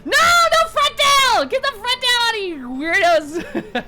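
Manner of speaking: very high, loud mock yell; laughing mock scolding; playful yelling.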